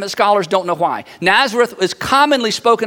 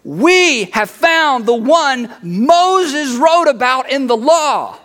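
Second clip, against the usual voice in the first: higher, slow, emphatic proclamation; excited announcement; 4 semitones higher, much slower than usual. Acted. Philip tells his friend the big news, excited. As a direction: declare amazing news, stretching each key word. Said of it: "he's so excited about this".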